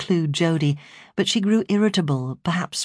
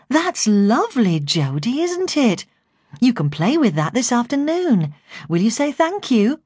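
coaxing excitement; 7 semitones higher than usual. A narrator's reading. Carer coaxing Jodie to show excitement over a present, high and gushing. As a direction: coax a child with high, swooping enthusiasm.

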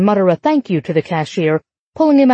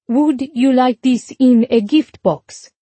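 put-on Italian accent; 4 semitones higher, slower than usual. A narrator's reading. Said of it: "In a thick Italian accent".

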